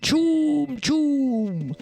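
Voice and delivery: two long, high whoops; hammed-up orc chant, held high; excited orc cry. Orcs showing excitement before battle, whooping high. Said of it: "a way for orcs to show excitement".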